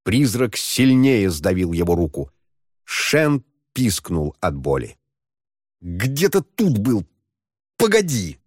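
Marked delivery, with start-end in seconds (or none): 5.8-8.4 irritated. Acted. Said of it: "раздражённо произнёс он".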